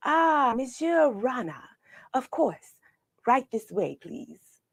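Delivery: light French maître d' lilt; French waiter. Acted. French waiter welcoming Mister Rana, lightly accented. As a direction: welcome him like a grand French host.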